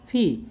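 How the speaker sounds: फी with a breathy प-फ sound for P; corrected sound. Shown as a demonstration. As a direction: say फी, a puff of air on the P. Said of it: "प और फ का sound लगाएंगे".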